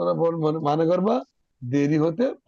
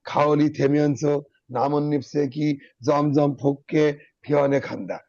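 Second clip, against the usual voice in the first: slow phrased reading; 4 semitones lower, slower than usual. Shown as a demonstration. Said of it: "দেখো কিভাবে পড়বা আমি বলে দিই".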